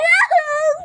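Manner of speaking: high, loud whoop; excited cheer. Real, not acted. Player cheers the result of the turn, excited. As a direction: whoop out in celebration.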